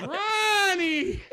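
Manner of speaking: loud, high, laughing shout; excited shout. Retold or imitated. [name] shouting back to Ronnie on the next balcony, thrilled. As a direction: yell his name in delighted surprise. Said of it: "I'm like".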